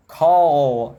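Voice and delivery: All with a heavy drawn dark L; strong dark L. Shown as a demonstration. Teacher showing the strong regional L. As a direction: hit the L hard in All. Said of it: "Esse L, ele fica muito forte".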